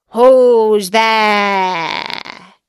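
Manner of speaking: loud, drawn-out wolf call; big wolf voice. A narrator's reading. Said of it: "hearing the big voice of the wolf".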